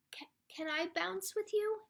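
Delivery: high, hushed, halting request; timid duckling. Acted. Duckling hesitantly asks to bounce with friends, timid tiny voice. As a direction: ask in a small, high, whispery voice.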